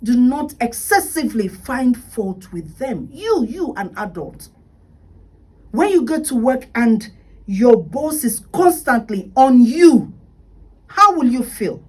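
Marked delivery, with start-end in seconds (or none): 5.7-11.8 raised voice. Real, not acted. Indignant point about a nagging boss.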